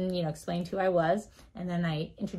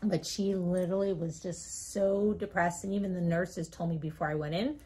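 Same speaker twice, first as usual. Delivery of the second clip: normal talking voice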